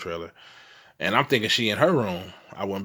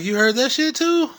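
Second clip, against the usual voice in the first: high and loud, can't believe it; shocked; 5 dB louder than usual. Retold or imitated. Telling his wife he heard the ghost too, shocked. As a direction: blurt it out, stunned.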